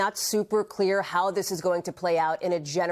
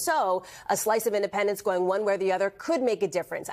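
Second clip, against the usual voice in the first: could given the weight in "so a slice of independents going one way or the other could make a difference"; stress on could. Real, not acted. Correspondent to the anchor, stressing the word could. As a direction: lean on the word could, then let the line settle. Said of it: "I emphasize the word could".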